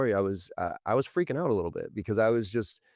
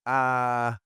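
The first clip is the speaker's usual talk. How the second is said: mock stunned uh; 4 semitones higher, 3 dB louder than usual. Pretends to be stunned.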